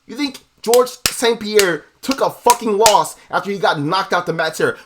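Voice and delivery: usual speaking voice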